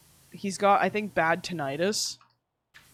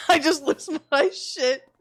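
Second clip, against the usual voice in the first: usual speaking voice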